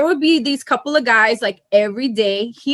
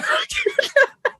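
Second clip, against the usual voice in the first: very high shrieking laugh; squealing laugh; 3 dB quieter than usual. Real, not acted. Laugh at own story.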